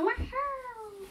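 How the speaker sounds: high, squeaky whoop; a gleeful little cheer for the jump; playful high whoop; excited cheer. Pretend. Figure cheers after a jump.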